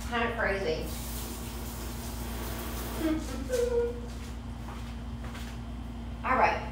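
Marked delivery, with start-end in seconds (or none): 0.9-6.2 long breathy giggle; long high laugh. Real, not acted. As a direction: let out a long airy laugh. Laughs after covid remark.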